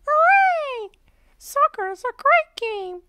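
very high, loud cheer; cheering Peppa Pig. A narrator's reading. Peppa cheers that soccer is great, very high and loud. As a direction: cheer with delight. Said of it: "cheers Peppa".

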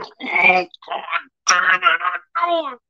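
high-pitched, strained mock wail; anguished wail. Jealous husband imagining his wife with the priest, hammed-up wail.

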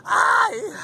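high yelp of cold shock; sharp shriek. Real, not acted. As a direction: shriek at the plunge. Sudden cry in cold water. (grito agudo de frio)

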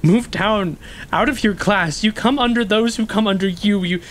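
ordinary delivery

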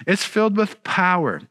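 usual speaking voice

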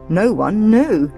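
plain reading voice

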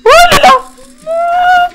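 panicked high-pitched cry; scared horror game streamer. Real, not acted. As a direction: shriek in real fright. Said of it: "oh putain, j'ai eu peur".

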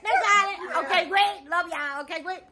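high, chirpy sign-off; bright high wrap-up; high sing-song. Real, not acted.

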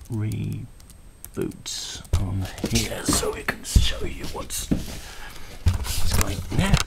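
slow whisper to himself; whispered aside. Real, not acted. Demoing a laptop, muttering to himself as it reboots. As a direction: breathe the words under your breath while busy.

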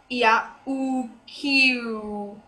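"ya u q" sound by sound, very slowly, with a Y glide; slow teacher's demo of "ya u q"; phonetic sounding-out. Shown as a demonstration. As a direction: stretch out "ya u q" as separate phonemes. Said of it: "the j sound is pronounced as y".

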